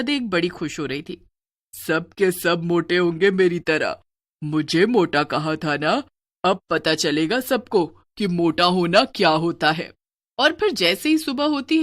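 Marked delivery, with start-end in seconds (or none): 1.7-9.9 slow, measured villainous relish; gloating witch. Acted. Witch gloats over those who mocked her, slow relish. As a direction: savour each word of the revenge, slowly.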